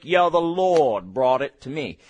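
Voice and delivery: low, drawn-out pious believer; a hint of slow, low reverence; believer's mock piety. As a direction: lightly mimic a contented churchgoer, low and slow. Mimics believers crediting the Lord.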